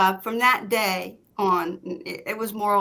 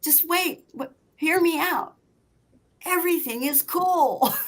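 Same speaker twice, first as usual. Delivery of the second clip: high, stretched mock reassurance; imitated calming; 9 semitones higher than usual. Husband quieting his freaked-out wife, imitated calming. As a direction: voice a husband pleading for calm, pitched high.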